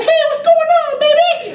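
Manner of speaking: high, smooth, slick pimp voice; smooth flashy pimp swagger. Retold or imitated. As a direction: slide into a flashy pimp greeting. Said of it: "like a pimp voice like that".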